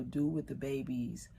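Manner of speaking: ordinary delivery, nothing marked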